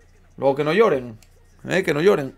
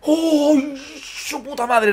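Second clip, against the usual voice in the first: excited cry; 10 semitones higher, much slower than usual. Real, not acted. Reacting to a chance.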